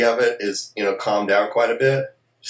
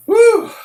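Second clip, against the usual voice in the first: pained whoop; 9 dB louder than usual. Real, not acted. Reacting to burning hot pepper.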